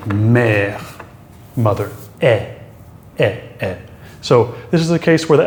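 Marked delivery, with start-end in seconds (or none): 0.1-1.0 "mère" with open eh vowel; drawn-out French "mère" as an example. Shown as a demonstration. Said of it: "you'll see this, for example". 2.2-3.8 short, falling open "eh"; accent grave sound on "eh"; muted French è. Shown as a demonstration. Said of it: "this one is a little more muted".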